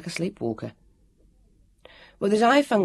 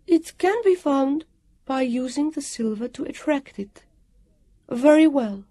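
high, ringing, stately woman's voice; strong and comforting; 10 semitones higher than usual. A narrator's reading. Guardian agreeing to break her injunctions, strong comforting voice. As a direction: pronounce it with reassuring authority. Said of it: "Her voice rang out strong and comforting".

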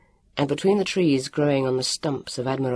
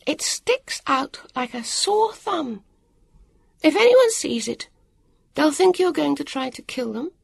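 higher, slowed, pointed warning; scolding harassed woman; 11 semitones higher than usual. A narrator's reading. Woman scolding children about armour, high and stern. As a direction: warn them off their foolish armour.

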